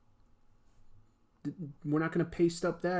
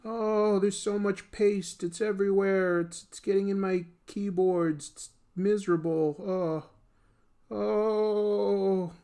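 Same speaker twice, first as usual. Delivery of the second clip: mock misery; 4 semitones higher, 4 dB louder, slower than usual. Hero moaning about paste.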